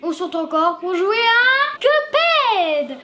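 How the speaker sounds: loud, high streamer greeting with stretched words; big drawn-out opening shout; hyped-up intro. Real, not acted. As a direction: belt out the video intro, drawing out the game title.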